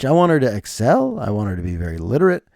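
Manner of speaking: ordinary delivery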